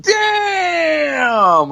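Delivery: very high, loud mock crowd shout; crowd's damn. Comedian voicing the crowd's roar at his comeback, loud shout. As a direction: shout it like a whole room reacting. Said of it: "And the crowd's like".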